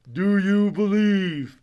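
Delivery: high, loud, slow voice from the heavens; slow heavenly boom; booming voice from above. Retold or imitated. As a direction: boom it down slowly like a voice from the sky. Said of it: "a voice billows down".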